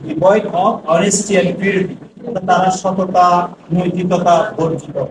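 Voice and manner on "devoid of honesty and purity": very slow, word by word; measured dictation pace. Shown as a demonstration. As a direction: spell it out slowly for the class. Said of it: "teacher reads sentence for dictation".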